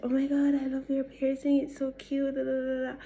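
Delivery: high-pitched gushing over someone; drunk gushing. Retold or imitated. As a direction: gush in a high, tipsy party-girl voice. Drunk gushing to disliked girl.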